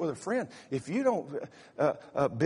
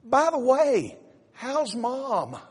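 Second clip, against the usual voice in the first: breezy wife; 7 semitones higher, much slower than usual. Retold or imitated. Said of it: "And she goes".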